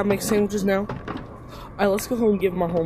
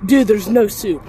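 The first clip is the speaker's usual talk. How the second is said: loud; 2 semitones higher, 8 dB louder, slower than usual. Real, not acted. Repeats louder in disbelief.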